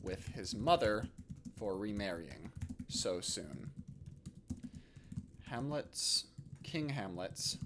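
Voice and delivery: slow, halting dictation with long pauses; composing aloud. Real, not acted. Teacher drafting a play summary for students, slow dictation. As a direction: dictate softly to yourself, phrase by phrase.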